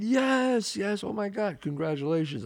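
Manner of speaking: high, drawn-out happy outburst, easing down; retold delighted congratulations. Congratulating a friend who got made, excitedly. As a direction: celebrate a friend's news, starting way up high.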